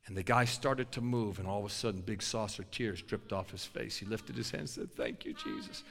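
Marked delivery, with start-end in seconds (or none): 4.9-5.8 tearful thanks. Retold or imitated. Big guy tearful thanks.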